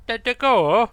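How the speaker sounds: loud, high mocking parrot of the line; mocking NPC voice. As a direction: echo it back in a squeaky mock voice. Gamer mocks the NPC line.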